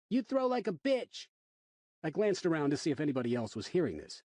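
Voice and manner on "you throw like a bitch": put-on rude kid. A narrator's reading. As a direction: sneer it like a mouthy punk. Kid insulting narrator.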